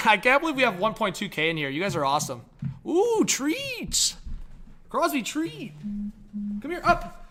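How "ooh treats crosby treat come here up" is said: very high pet-talk voice; coaxing the dog. Real, not acted. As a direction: lure a dog with treats, voice pitched way up. Luring the dog with treats.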